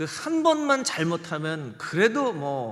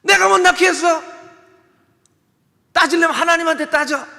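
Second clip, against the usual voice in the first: much higher, loud yelling; Jacob's angry retort; 12 semitones higher, 12 dB louder than usual. Acted. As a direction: snap back in exasperation. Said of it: "야곱도 너무 이 상황에 화가 나는 거예요".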